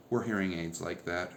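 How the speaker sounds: usual speaking voice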